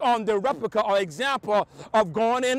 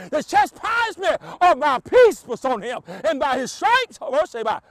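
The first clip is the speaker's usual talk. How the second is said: preaching at full force; 4 semitones higher, 3 dB louder than usual. Acted. Pastor preaching to the congregation, full force. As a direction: pitch raised and loud, shouting the refrain. Preacher builds to shouting climax.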